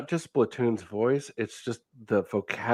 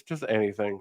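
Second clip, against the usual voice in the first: ordinary talk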